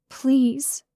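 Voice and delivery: high, pleading please; a breathy, longing plea; begging. A narrator's reading. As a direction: beg him for it. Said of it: "I begged".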